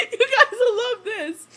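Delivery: slow words broken by laughter; laughing slowly. Real, not acted. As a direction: squeeze it out through giggles. Repeats line through laughter.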